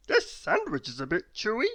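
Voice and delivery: puzzled silly voice; muddled man voice. Acted. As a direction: muse like a dim fellow. Mister Muddle's voice.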